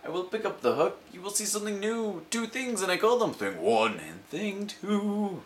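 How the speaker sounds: high, grand ringmaster cat, slowing on the names; showman cat. Acted. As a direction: present the Things like a magician.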